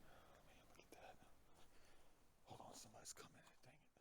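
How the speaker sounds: very soft, near-whispered aside; hushed. Real, not acted. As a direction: drop to a whisper so passers-by won't hear. Pauses as someone is approaching.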